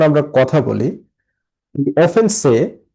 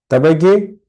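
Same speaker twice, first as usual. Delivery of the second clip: ordinary talk, nothing marked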